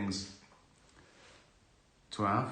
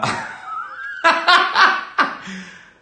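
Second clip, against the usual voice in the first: sudden loud high laugh; real laugh; 10 dB louder than usual. Real, not acted. Laughing mid-story.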